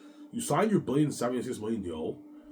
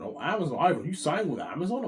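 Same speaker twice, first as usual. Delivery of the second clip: higher, surprised put-on voice; voicing Peter; 7 semitones higher, 4 dB louder than usual. Peter amazed that Ivan signed, pitched up. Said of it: "I'm like".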